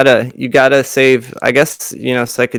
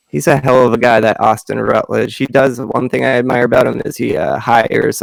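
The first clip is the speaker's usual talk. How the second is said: usual speaking voice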